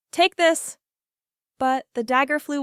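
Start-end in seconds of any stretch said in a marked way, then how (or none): 0.1-0.8 shouting. Acted.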